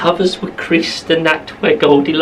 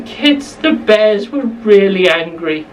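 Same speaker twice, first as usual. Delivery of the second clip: usual speaking voice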